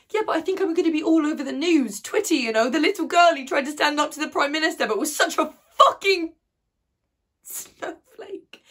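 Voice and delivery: loud, high outburst, barely pausing; angry self-mockery. Girl dreading being all over the news, angry outburst. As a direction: spit out your humiliation at full force.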